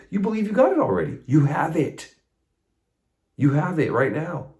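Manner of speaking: excited. Real, not acted. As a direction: burst out with the good news.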